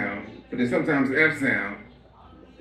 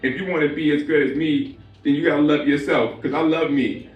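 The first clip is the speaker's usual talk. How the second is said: Jay-Z speech. Acted. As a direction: brag about yourself like a star. Jay-Z self-love speech.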